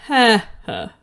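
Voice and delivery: loud, slow mock laugh, each ha spelled out; a spoken, not-quite-real stage laugh; Magenta's laugh. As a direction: ham up Magenta's deadpan ha ha ha. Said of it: "Magenta goes".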